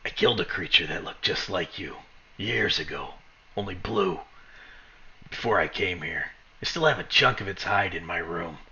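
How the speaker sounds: low, softened voice, not fully whispered; understated menacing whisper. Acted. Guard threatening his captive in the ear, hushed menace. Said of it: "he whispers in your ear".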